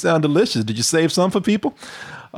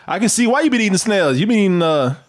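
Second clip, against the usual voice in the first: ordinary talk, nothing marked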